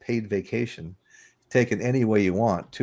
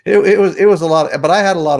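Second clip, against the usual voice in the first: excited recollection; 8 semitones higher, 10 dB louder, faster than usual. Real, not acted. Laughing about chaotic trip.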